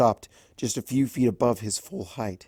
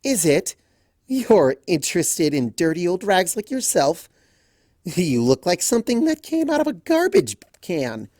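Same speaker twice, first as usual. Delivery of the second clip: sneering; 7 semitones higher, 4 dB louder than usual. A narrator's reading. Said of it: "Lime cackled".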